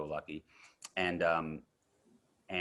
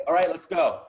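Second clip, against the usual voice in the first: loud, high rallying shout; suddenly loud, brisk retold command; calling out to set; 12 semitones higher, 13 dB louder than usual. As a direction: imitate a director rousing the crew.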